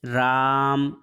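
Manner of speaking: राम with the long aa held triple length; slow, drawn-out राम; prolonged vowel. Shown as a demonstration. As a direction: stretch out the middle of राम on a steady note. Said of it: "इसका तात्पर्य है तिगुना समय".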